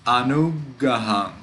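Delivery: Anugaham with a doubled, held g; pause at the G. Shown as a demonstration. Teacher confirming the reading, Anugaham with a little pause at the g. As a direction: linger on the g of Anugaham before gaham. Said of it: "made a little pause at the G".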